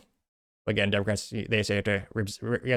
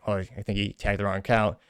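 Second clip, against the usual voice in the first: ordinary talk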